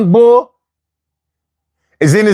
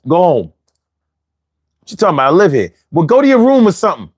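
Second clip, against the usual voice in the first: usual speaking voice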